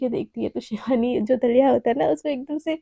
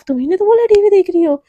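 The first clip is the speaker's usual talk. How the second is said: cheeky loud retort; 6 semitones higher, 8 dB louder than usual. Acted. Teasing roommate retorts over TV.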